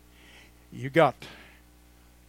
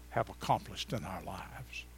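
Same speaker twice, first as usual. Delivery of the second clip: dramatic whisper; 7 semitones lower, 8 dB quieter than usual. Acted. Sermon point dropped to whisper.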